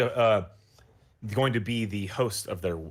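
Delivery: ordinary talk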